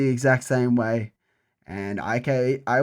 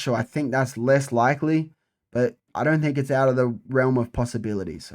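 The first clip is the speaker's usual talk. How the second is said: ordinary talk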